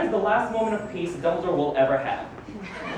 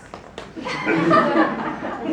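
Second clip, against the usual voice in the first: amused chuckle; 8 semitones higher than usual. Acted. Mocks "omniscience" in the book.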